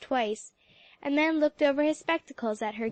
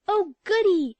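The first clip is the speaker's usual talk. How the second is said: high loud squeal of delight; delighted cry; 8 semitones higher, 7 dB louder than usual. A narrator's reading. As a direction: cheer like a girl hopping for joy. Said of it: "cried Alexia".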